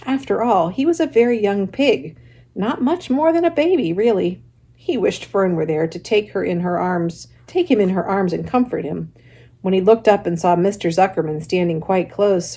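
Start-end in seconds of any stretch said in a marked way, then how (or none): none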